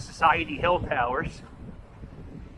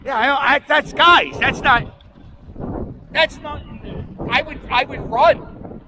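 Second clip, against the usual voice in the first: scared stammer; 7 semitones higher, 4 dB louder than usual. Retold or imitated. Scared statue friend stammering.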